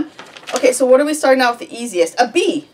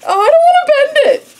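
loud, high-pitched squeal of reluctance; squeamish dread; 7 dB louder, faster than usual. Real, not acted. Balloon twister dreads bending it, squeamish squeal. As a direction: whine loudly at a very high pitch.

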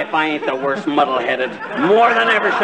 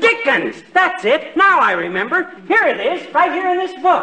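excited discovery; 9 semitones higher than usual. Acted. McGee suddenly remembers hiding place.

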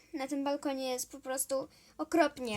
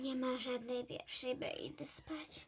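sleepy whisper; 2 semitones lower, 9 dB quieter than usual. Acted. Headmistress retreats to sleep.

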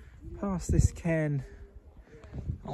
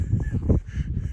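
laugh; 3 dB louder than usual. Real, not acted. Laughing at fake barrow.

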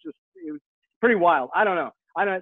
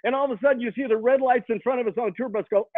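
usual speaking voice

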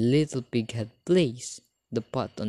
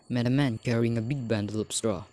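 ordinary delivery, nothing marked

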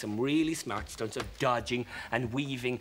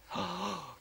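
gasp; 5 semitones higher, 7 dB quieter than usual. A narrator's reading. Shock at the giant ship.

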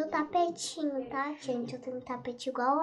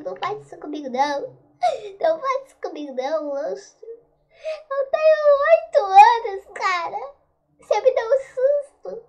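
high tearful panicked cries; panicked crying; 7 semitones higher, 10 dB louder than usual. Real, not acted. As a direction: beg through tears. Crying out at monster.

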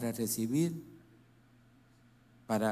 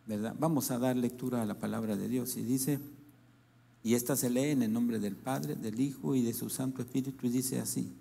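ordinary delivery

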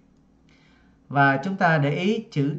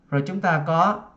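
ordinary talk

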